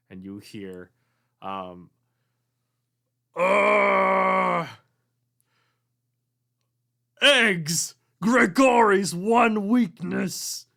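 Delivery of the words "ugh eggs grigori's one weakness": high, loud, slow ghostly yell; ghostly shout. Acted. Ghost yelling in bathroom.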